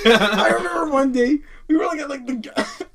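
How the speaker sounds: laughing through the words. Real, not acted. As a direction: try to talk through laughter. Laughing fit mid story.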